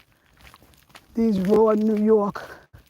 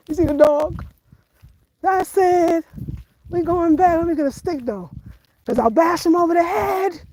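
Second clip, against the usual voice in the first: rattled by the dog, talking fast and high; alarmed walker eyeing a dog; agitated; 7 semitones higher than usual. Real, not acted. Scared of approaching dog.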